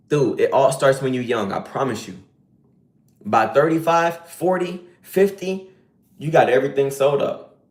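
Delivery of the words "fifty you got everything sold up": usual speaking voice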